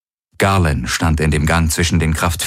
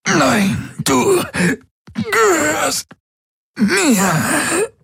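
very high, crying, otherworldly claim; possessive alien voice; much slower than usual. A narrator's reading. The Helix claims his body, in a high eerie crying voice. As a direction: sing it like a possessive alien force.

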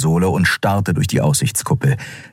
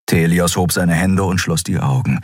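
plain reading voice

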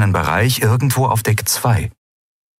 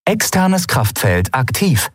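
slow, even machine announcement; the ship suddenly announces its shield is active; ship computer voice; 7 semitones higher, slower than usual. Acted. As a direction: report it like an onboard computer. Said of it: "meldete die Sternenreiter unvermittelt".

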